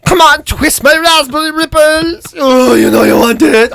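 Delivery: a treat purring for attention; a different chocolate tempting him, high and slow; seductive chocolate. Acted. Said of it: "that might have a different accent".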